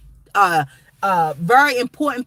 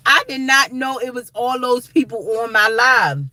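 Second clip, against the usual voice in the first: ordinary talk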